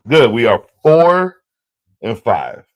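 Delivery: loud, slow, satisfied count; loud satisfied tally; pleased. Real, not acted. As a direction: announce it proudly. Celebrating his record.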